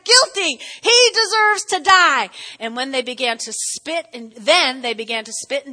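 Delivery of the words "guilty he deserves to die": high, loud mob shout; shouting crowd. Acted. Council condemning Jesus, loud mob shout. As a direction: yell the verdict together. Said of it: "everybody shouted".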